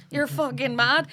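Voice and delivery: loud, high mock Londoner; London accent. English husband judging the locals, loud London accent. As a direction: exclaim it in an imitated London accent. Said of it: "He's from London".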